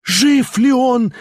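high, slow, frantic cry; frenzied cry. A narrator's reading. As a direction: shriek it in a frenzy. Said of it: "вскричала она в исступлении".

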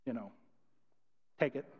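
mock a meek, apathetic pitch; unenthusiastic demo. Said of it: "we can't go into the world with this".

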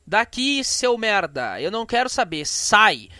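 loud, furious telling-off; angry shout. Pretend. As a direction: yell him out of the house. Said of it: "tu fala assim".

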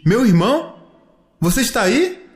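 high, rising, searching question; hopeful-sounding high query; questioning call. Acted. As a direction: call out unsure who is there. Child calls toward brother's voice.

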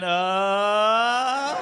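long, drawn-out "uh" climbing in pitch; stuck, wordless comic panic; panicked noise. Retold or imitated. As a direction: hold a helpless panicked vowel as it rises. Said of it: "I panicked even more and just went".

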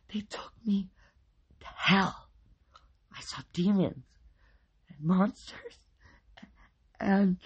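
high, halting, haunted delivery; frightened. A narrator's reading. Husband tells police about demons, frightened. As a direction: falter between phrases as if traumatized.

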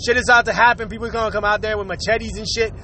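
ordinary talk

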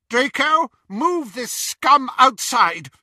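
high, loud, slow witch order; Bellatrix voice. A narrator's reading. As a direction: command with contempt.

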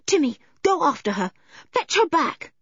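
high, urgent order to a dog; panicked command. A narrator's reading. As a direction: send the dog off in alarm. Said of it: "yelled George in a panic".

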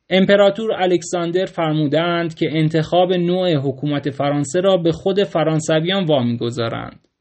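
ordinary delivery, nothing marked

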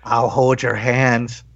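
low, slow, breathy creep; creepy man voice. Acted. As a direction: whisper it like a lurking creep. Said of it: "in my most creepy man voice".

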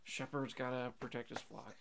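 soft, halting, half-hearted line reading; reluctant actor. Retold or imitated. Mocking an actor thinking about his paycheck, reluctant delivery. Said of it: "he didn't even wanna say it".

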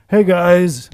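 loud hail across a distance; calling out. Retold or imitated. Injured man hailing us across the parking lot, calling out. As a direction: call over to strangers far off. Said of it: "across the parking lot to us".

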